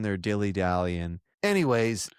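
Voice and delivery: ordinary talk, nothing marked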